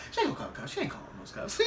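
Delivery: soft, near-whispered reassurance breaking into laughter; laughing whisper. Retold or imitated. Barber reassuring his nervous passenger, whispered and laughing. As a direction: laugh it off under your breath.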